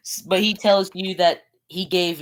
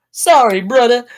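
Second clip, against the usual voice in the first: loud, higher put-on Western accent; weird Western accent; 6 semitones higher, 10 dB louder than usual. Player voicing Lucas on the phone, weird Western accent. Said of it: "like, a weird, like, Western accent".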